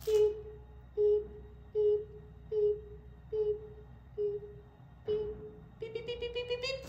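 high beeping like a reversing truck; backup beeps. Pretend. Reading to kids, high reversing truck beeps. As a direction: beep it out high and bright.

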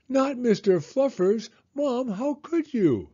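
high, loud wail of protest; outraged kid. A narrator's reading. Son to mom taking his bunny, outraged. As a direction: wail it like a betrayed little boy.

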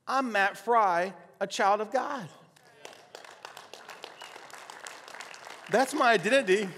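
loud, high declaration, slowing on the name; emphatic preaching. Retold or imitated. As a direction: build to a ringing proclamation of who you are.

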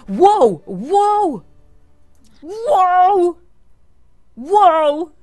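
loud, high amazed wows. Real, not acted. Gamer stunned by what the Sim did, shouting amazed wows. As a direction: gasp out wow at each new sight.

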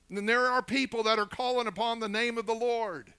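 high, loud, impassioned preaching; full-force preaching. Real, not acted.

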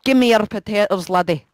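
put-on Irish accent; exaggerated Irish lilt; mock Irish. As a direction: play it in a stage Irish brogue. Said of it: "speaking Irish".

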